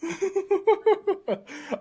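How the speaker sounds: high, slow words through laughter; laughing. Real, not acted. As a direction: laugh as you agree. Laughing at friend's joke.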